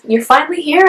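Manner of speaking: retold tender greeting, pitched high; emotional greeting. Mother to her just-born baby, tender high greeting. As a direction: greet your newborn in a high, moved voice.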